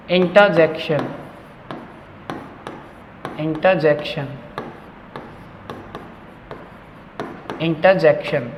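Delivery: इंटरजेक्शन as "inta-jekshan", repeated for pupils; correct pronunciation. Shown as a demonstration. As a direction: model इंटरजेक्शन with no R. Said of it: "मैं आर sound नहीं कर रहा हूँ". (इंटरजेक्शन में र की आवाज़ नहीं)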